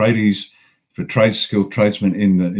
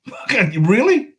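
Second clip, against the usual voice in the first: slow, much higher disbelieving "really"; incredulous; 11 semitones higher, much slower than usual. Real, not acted. Scoffing at whingers who want cheap housing, slow incredulity. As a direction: drag out the disbelief.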